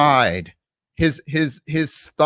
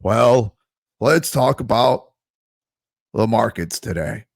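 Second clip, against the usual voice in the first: slow mock delivery with long pauses; talking slowly; 4 semitones lower, 3 dB louder, slower than usual. Mimicking a rival's market update, slow and halting. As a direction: stall between phrases, imitating a plodding presenter. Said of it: "he just talks slowly".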